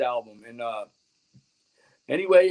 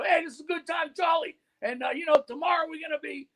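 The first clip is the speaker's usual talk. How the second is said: radio host promo; 10 semitones higher than usual. Acted.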